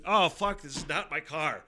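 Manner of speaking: high, loud sudden alarm, dropping on the last words; sudden horror at sitting in the wrong car; retold shocked outburst. Retelling his shock at the stranger's car, high and loud. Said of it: "and I was just like".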